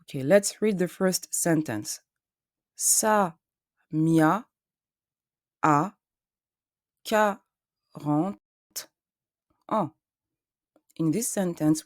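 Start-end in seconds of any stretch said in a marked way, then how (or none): 2.8-9.9 slow French, syllable by syllable with long gaps; syllabe par syllabe. Shown as a demonstration. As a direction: sound out each French syllable separately, deliberately. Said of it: "emphasize the syllables".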